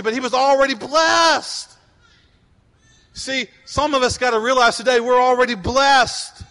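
loud, driving sermon voice; fiery preaching. Real, not acted. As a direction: preach it to the room. Preaching at full force.

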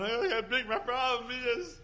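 hammed-up panic about the problem; fretting worrier. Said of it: "chasing my problem around, going".

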